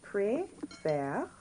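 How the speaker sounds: "Préfère" in two separate syllables, rising at the end; teacher modelling "Préfère" in careful separated syllables; changed accent. Shown as a demonstration. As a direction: sound out "Préfère" for learners, open è lifted upward. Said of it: "we see that this accent is changed".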